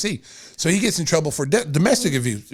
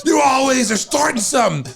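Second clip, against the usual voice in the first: mock angry yell; 7 semitones higher than usual. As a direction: bark it at the loudmouth in back. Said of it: "coach scolding".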